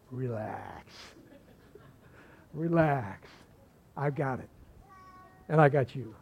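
low, soft, drawn-out reassurance; hushed and calm; reassuring. Acted. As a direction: settle them down gently, voice dropped low. God's reassurance voiced to congregation.

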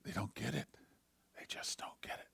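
fading almost to a whisper; low, sorrowful murmur; retold tearful pastor. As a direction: retell it hushed, as if too grieved to speak up. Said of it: "he started to cry".

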